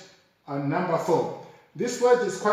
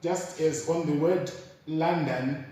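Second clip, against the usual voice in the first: usual speaking voice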